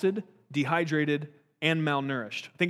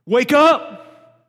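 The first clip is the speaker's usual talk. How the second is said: higher, very loud rousing yell; shout wake up; 8 semitones higher, 14 dB louder than usual. Acted. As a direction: bark it to snap them out of it. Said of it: "grab somebody by the shoulders and shake 'em".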